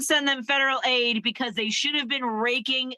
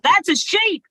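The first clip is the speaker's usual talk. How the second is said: retold yell; 7 semitones higher, 6 dB louder, slower than usual. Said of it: "someone yelled out".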